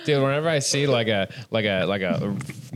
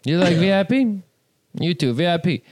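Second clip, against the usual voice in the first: put-on Persian salesman accent; 6 semitones higher, 3 dB louder than usual.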